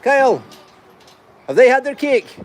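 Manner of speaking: high-pitched call to someone away. Real, not acted. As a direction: call over to a helper with a question. Calling question to Kyle at distance.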